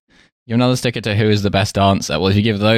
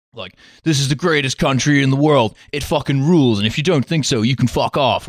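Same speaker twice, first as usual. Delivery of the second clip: lightly voiced patriot bragging, pitched higher; mock chest-thumping American pride, higher; gung-ho American patriot; 4 semitones higher than usual. Voicing American patriotism for a friend, gung-ho boast. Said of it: "American patriotism is like".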